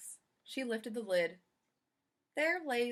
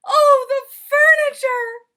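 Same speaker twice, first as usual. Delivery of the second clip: very high, loud, slow wail of dismay; cry of dismay; 22 dB louder, slower than usual. Acted. Missus Rogers seeing the dusted furniture, loud slow cry of dismay. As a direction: wail it out as she sees the ruin. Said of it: "she cried".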